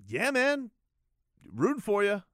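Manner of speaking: hammed-up buddy encouragement; overly chummy. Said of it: "overly chummy".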